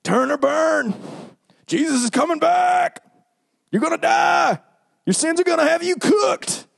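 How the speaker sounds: street preacher yell. Retold or imitated. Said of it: "with megaphones and yell at people".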